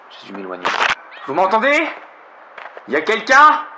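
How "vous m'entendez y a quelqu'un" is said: loud rising call for help; calling from far away. Acted. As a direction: call out, hoping someone hears. Lost in forest, calling for help.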